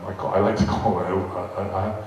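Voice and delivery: ordinary delivery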